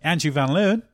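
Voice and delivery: joking attempt at Australian; attempted Australian accent. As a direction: have a go at an Aussie accent. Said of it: "my accent when I do Australian".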